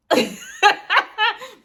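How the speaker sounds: high, loud laugh of disbelief; shrieking laugh; incredulous laugh. Real, not acted. Reacting to car key demand.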